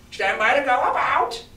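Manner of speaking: high squawked parrot order; parrot cry. Acted. Parrot calling ship's order, high squawk. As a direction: screech it like the parrot.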